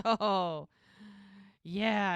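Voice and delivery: loud, drawn-out oh yeah; retold hearty confession, stretched out; emphatic admission. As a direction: admit it big and loud. Retold emphatic admission of being scared.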